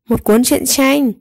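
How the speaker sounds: ordinary delivery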